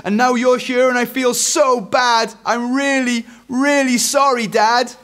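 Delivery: high, loud, breathless-style gush; blurted confession. A narrator's reading. Eric confesses everything to Dad, blurting. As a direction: pour it all out, pitched right up. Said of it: "blurted Eric".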